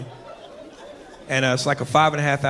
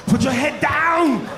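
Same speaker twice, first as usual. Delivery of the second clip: slow high mock command; 9 semitones higher, much slower than usual. Man pushing woman's head.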